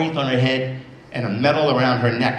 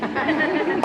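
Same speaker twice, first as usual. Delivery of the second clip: put-on high child's giggle. Girl laughing after teasing.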